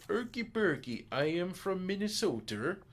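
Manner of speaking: put-on high, loud Swedish accent. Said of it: "I'm not having a Swede with".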